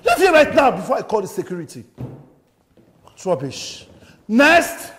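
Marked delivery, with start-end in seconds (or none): 0.0-1.8 loud, high-pitched dismissal; explode, then rush out the threat; angry shouting. Acted. Director throws out failed auditionee. 4.3-4.9 loud, high shout to the waiting room; irritated call-out. Acted. Irritated director calls next auditionee.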